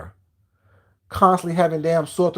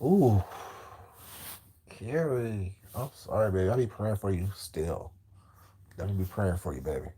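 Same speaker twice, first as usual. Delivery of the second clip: much softer, lower sarcastic pity; mock sympathy; 9 semitones lower, 12 dB quieter than usual. Real, not acted. Taunting Carrie with fake prayers, soft and low. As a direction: sweetly fake consolation.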